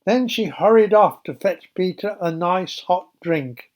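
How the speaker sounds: usual speaking voice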